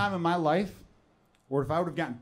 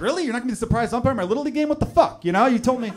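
put-on high, fast, indignant kid voice; whiny disappointed kid; 7 semitones higher, 6 dB louder, faster than usual. Soldier's kid let down by dad, high whiny outrage. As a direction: complain like a let-down little boy. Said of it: "And they're like".

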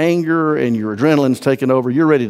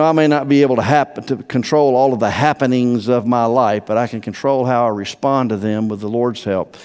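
ordinary talk, nothing marked

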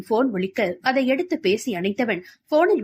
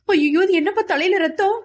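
high, louder cry of alarm; a frightened mother seeing blood, much higher and louder; 8 semitones higher, 4 dB louder than usual. A narrator's reading. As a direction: gasp it out in horror. Mother sees son's bleeding head.

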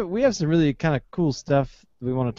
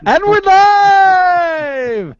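long, stretched, high announcer shout; excited shout; 13 semitones higher, 6 dB louder, much slower than usual. Real, not acted. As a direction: stretch it out like opening a live show. Opening the show live.

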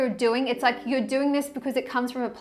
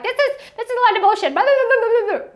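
higher, louder, fast scoffing blah blah; mocking dismissive rant; 10 semitones higher, 7 dB louder, faster than usual. Excessive talker dismissing it all, fast loud scoff. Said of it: "you're excessive and you're like".

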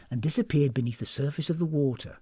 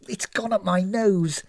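higher, slow, drawn-out complaint; spluttering cry; 5 semitones higher, slower than usual. A narrator's reading. Dunked guest gasping at companion, spluttering complaint. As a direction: whine it after a dunking. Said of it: "cried Missus Ish, still gasping".

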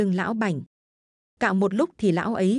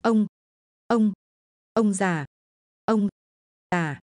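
stammering in fear; slower than usual. Acted. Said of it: "Lão ú ớ trong cổ họng".

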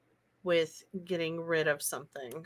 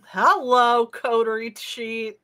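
playful greeting; 4 semitones higher, 7 dB louder, slower than usual. Acted.

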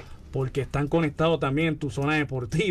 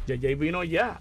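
normal talking voice

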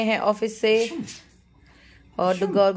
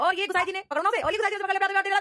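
high, climaxing play-by-play; excited commentary; 12 semitones higher, much faster than usual. Pretend. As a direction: call the catch. (ऊँची जोशीली commentary)

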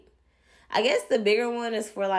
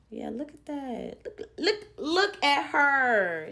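higher, cooing look at her; high admiring voice; 7 semitones higher than usual. Real, not acted. Vlogger admiring her finished curls, high cooing voice. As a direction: coo at the curls like a cute pet.

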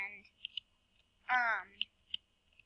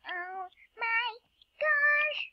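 high, rising exclamation; amazed squeal; 11 semitones higher, much slower than usual. Real, not acted. Player amazed by Jurassic Park map, high squeal. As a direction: let it climb into a high squeal.

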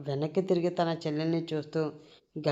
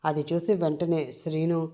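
plain reading voice

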